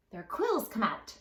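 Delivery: ordinary delivery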